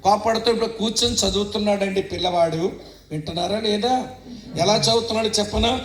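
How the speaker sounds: normal talking voice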